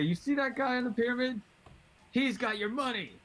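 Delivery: high-pitched, pointed call-out; in-character goading. Acted. Player tricking the half ogre into attacking, high-pitched goading. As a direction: pitch it way up to set someone on a target. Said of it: "Yeah, I say".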